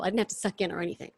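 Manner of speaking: usual speaking voice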